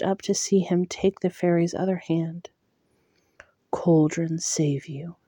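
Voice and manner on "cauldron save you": slow, hushed blessing, no voice; whispered prayer. Acted. Tamlin whispers a blessing over the dying fairy. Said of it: "reciting the words of a prayer".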